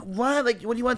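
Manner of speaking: high-pitched retort, squaring up; retold confrontational "what", thrown back high; defiant challenge. Girl answering boy across the table, defiant.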